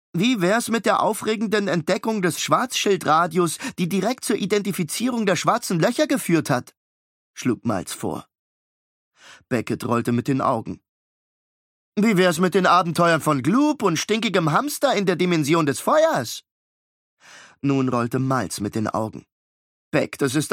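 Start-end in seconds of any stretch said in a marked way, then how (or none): 0.1-6.7 put-on higher, precise know-it-all boy; clever twin. Miles correcting his brother, higher know-it-all boy. Miles proposing a science story. 12.0-16.4 put-on much higher, louder gleeful boy; excited little boy. Beckett praising his brother, high gleeful boy. Beckett proposing silly story.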